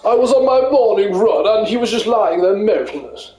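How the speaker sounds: a light posh runner voice. Acted. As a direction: play a smug posh jogger. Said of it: "it's just a fucking posh voice".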